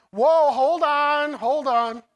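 hammed-up parents crying hold on, slowly; mock alarm. Pastor imitating alarmed parents, hammed-up alarm. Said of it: "I know you're all going".

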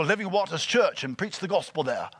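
stately British voice of God; majestic British accent. Acted. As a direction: send him off with mock-divine British grandeur. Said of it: "some British majestic accent".

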